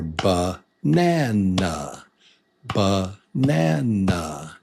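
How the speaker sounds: Banana stretched slowly, each vowel its own beat; syllable by syllable. Shown as a demonstration. Teacher showing where the beat lives, Banana slow and split. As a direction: say Banana in three long separate syllables. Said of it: "That's where the beat lives".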